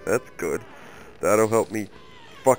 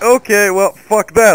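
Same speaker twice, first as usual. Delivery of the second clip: higher and louder fed-up blurt; exasperated outburst; 8 semitones higher, 6 dB louder than usual. Retold or imitated. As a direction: blow up in disgust at the food. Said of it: "So I'm like".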